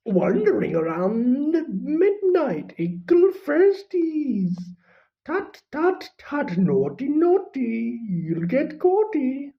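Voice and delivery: high, teasing singsong poltergeist; mischievous poltergeist. Acted. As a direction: taunt them like a gleeful imp. Peeves voiced, taunting.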